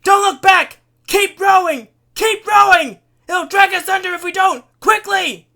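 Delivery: urgent, high, full-force commands; shouted orders. A narrator's reading. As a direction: drive the rowers on, yelling. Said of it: "Giff ordered".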